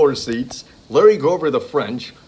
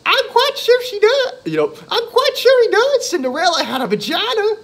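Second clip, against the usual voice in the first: squeaky falsetto lady voice, much higher and louder, laughing through it; a man putting on a high woman's voice for a prank caller bit; mock high woman caller; 5 dB louder than usual. Host mimics woman caller, falsetto and laughing. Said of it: "And she goes".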